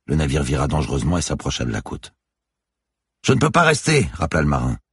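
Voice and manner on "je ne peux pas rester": high, loud sailor's call; urgent raised voice; shouted reminder. A narrator's reading. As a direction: make it clear over the wind. Sailor reminds her over the storm.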